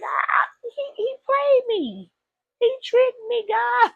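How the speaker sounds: put-on high, far, wailing girl; high-pitched whiny plea; whiny Eve. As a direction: wail it like a tricked girl. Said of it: "Eve was out there crying".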